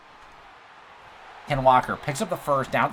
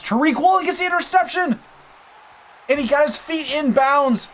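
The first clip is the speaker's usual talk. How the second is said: much higher and louder, yelling in delight; ecstatic shout; 6 dB louder than usual. Real, not acted. Gamer calling his team's interception, ecstatic yell. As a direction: erupt like a fan whose team just got the pick. Game-winning-chance interception called live.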